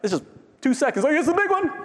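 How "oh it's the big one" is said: high-pitched alarmed outburst; mock quake panic. People in a little earthquake fearing the worst, mock panic. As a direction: yelp it in pretend alarm.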